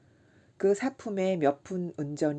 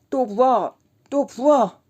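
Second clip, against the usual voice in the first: put-on higher, loud drunken shout; 8 semitones higher, 12 dB louder, slower than usual. A narrator's reading. Kim shouting for more drink, loud. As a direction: call out for another round. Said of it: "라고 외쳤다".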